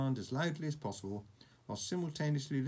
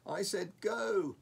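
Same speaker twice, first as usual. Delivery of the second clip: slow, high, louder exasperated command; frustrated; 9 semitones higher, 4 dB louder, slower than usual. Acted. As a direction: spell it out through frustration. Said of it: "Hiccup repeated in frustration".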